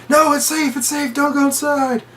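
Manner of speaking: high-pitched, urgent retold warning; mock panicked plea. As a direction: imitate a host begging people to stay. Franco urging fleeing guests.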